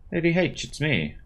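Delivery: calling out. Acted. Calling through cabin door.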